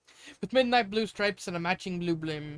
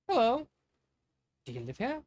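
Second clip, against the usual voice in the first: curious prince; 7 semitones higher than usual. Acted. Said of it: "Platinum spoke".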